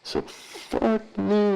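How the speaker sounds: ordinary delivery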